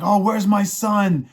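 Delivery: mildly hammed-up panicked dad, drawn out and high; worried father. Father finds his son missing.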